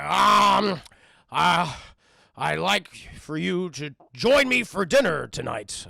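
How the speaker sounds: loud, halting, slow invitation; awkward Beast. Retold or imitated. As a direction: push the polite words out stiffly, with pauses.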